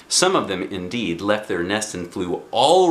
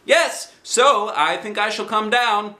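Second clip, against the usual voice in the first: high, bright bear voice calling out; Pooh's cheerful cry; 11 semitones higher than usual. Acted. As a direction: call like a happy little bear. Pooh voiced.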